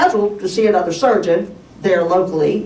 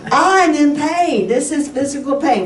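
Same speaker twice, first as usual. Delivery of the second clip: exasperated insistence; 7 semitones higher than usual. Retold or imitated. Patient insisting to doctor that pain is physical, exasperated. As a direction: insist on it, stretching the opening words.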